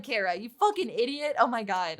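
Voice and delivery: usual speaking voice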